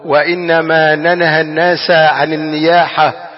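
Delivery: slow, weighty hadith recital, stretching every word; solemn recitation. Acted. Preacher to his congregation, quoting the Prophet, slow and solemn. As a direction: intone the Prophet's words slowly and gravely.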